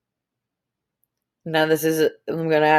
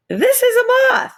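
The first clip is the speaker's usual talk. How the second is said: surprised; 6 dB louder than usual. Acted. Teacher reveals surprise twist.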